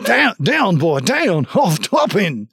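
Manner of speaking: mock German dog command; hammed-up German shout at a dog; German-ish command. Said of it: "speaking German all of a sudden".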